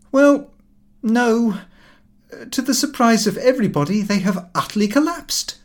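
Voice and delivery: lively son's voice, pitched high and fast; a brash young man breaking bad news breezily. A narrator's reading. Son telling father the shares collapsed, brash and high.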